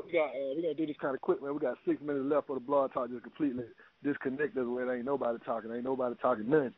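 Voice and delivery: usual speaking voice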